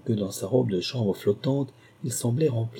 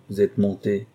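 usual speaking voice

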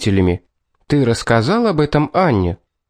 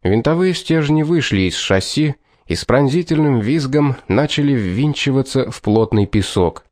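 ordinary delivery

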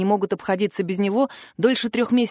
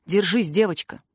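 plain reading voice

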